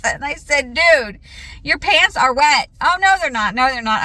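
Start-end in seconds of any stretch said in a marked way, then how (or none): 0.8-2.7 exasperated call. Retold or imitated. Mom calls out to son. 2.8-4.0 imitating son. Son's denial voiced.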